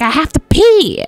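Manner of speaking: high-pitched urgent whine; desperate Kaylee. Acted. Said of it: "She's like".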